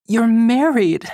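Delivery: forcing the words out in shock, pitched high; a stunned, high, loud "You're married."; words forced out. Acted. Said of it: "Cly forces the words out".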